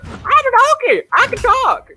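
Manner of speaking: very high, loud put-on squeal; old lady faking donkey. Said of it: "You even remember how he sounds".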